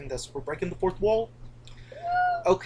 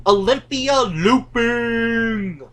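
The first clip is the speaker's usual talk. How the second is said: drawn-out, louder showman's call; big ride announcement; 3 semitones higher, 5 dB louder, much slower than usual. Shown as a demonstration. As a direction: announce it slowly with a flourish. Announcing the ride name with relish.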